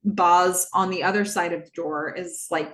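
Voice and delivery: normal talking voice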